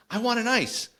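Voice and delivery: imitating a child's high-pitched demand; high mock kid voice, insisting; demanding little kid. Little brother begs mother for ice.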